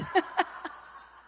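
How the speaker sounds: breathy burst of laughing; real laugh after the punchline; real laughter. Real, not acted. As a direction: laugh at your own joke. Preacher laughs at his punchline.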